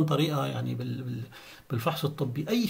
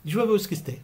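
quick, run-together doctor phrase; said fast like a doctor; 4 semitones higher than usual. Shown as a demonstration. Teacher showing how a doctor says it quickly, fast. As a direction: rattle it off as natives do. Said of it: "بيقولوها بسرعة هيك".

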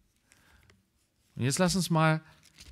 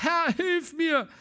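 high, slow, anguished cry; much higher slowed begging cry; desperate plea; slower than usual. Acted. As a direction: beg him on your knees. Woman kneels and begs.

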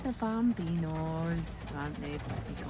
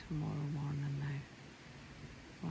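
tired whisper; 3 semitones lower, 9 dB quieter, slower than usual. Real, not acted. Exhausted after splitting wood.

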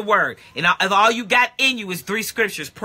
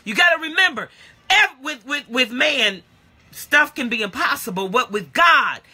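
higher, louder, driving sermon; full-force preaching; 5 semitones higher, 3 dB louder than usual. Acted. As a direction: preach it hard to the church.

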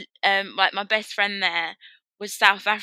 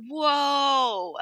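long, higher mock-amazed "whoa"; retold amazement; 5 semitones higher than usual. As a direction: draw it out as if imitating someone astounded. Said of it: "he was just like".

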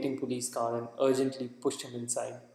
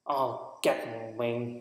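plain reading voice

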